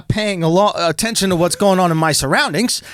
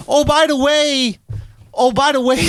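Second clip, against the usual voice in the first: casual understated warning; 6 semitones higher than usual. Retold or imitated. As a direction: mimic her mentioning it casually, taking your time. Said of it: "this is how she said it".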